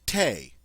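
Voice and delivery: thé said the French way, with a hard T and no th; the French word thé, T and a pure é. Shown as a demonstration. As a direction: say thé like tay, a clean T, stressed and high. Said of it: "as in the French word for tea".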